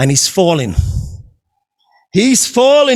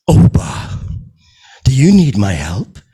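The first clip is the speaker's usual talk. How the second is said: much lower, solemn divine voice; voice of God; 12 semitones lower than usual. Acted. God calling down to Oba, much lower and solemn. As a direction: speak down from the heavens, calm and low. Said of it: "It is God".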